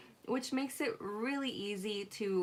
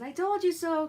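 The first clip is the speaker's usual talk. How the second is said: mock mom scolding; 7 semitones higher, 7 dB louder than usual. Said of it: "My first response is".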